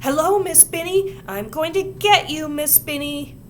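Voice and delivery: high, loud playful threat; muffled shout. A narrator's reading. Ramona shouting at her teacher through her mask. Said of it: "shouted in her muffled voice".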